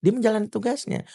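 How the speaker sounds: normal talking voice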